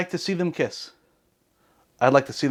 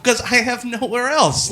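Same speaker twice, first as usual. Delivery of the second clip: laughing; 9 semitones higher, slower than usual. Real, not acted. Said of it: "laughs while saying it".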